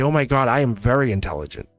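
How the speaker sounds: ordinary talk, nothing marked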